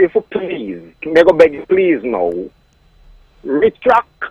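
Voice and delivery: normal talking voice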